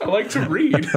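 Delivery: laughing imitation of a movie line; laughing quote. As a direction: quote it while cracking up. Laughing while retelling a film quote.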